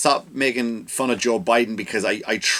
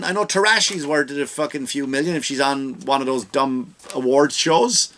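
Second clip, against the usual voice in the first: ordinary talk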